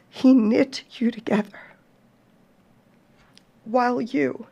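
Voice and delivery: fighting back tears as you read; real emotion pushing through the words; getting upset. Real, not acted. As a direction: voice rising and catching with emotion. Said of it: "Sorry, I'm getting all upset here".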